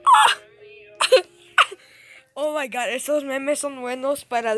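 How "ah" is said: very high, loud shriek; short scream. Real, not acted. Gamer calling for help mid-fight, sudden scream. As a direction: let out a sudden piercing yelp. Yells during game action.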